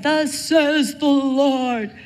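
booming mock prophet. Stalker proclaiming to Cheryl outside class, booming and slow. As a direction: proclaim it slowly like an oracle.

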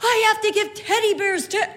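high, bright mock surprise at a silent class; mock amazed teacher. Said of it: "as a teacher, would go".

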